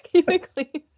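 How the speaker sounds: helpless giggly repeat; laughing echo. Real, not acted. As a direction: crack up while echoing the warning. Echoes co-host while laughing.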